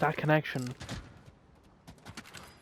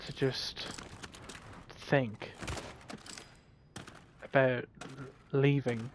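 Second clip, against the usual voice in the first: dropping to a whisper on the church; murmured, secretive retelling of the priest; hushed confession; 6 dB quieter than usual. Retold or imitated. Priest admitting he may leave the church, whispered.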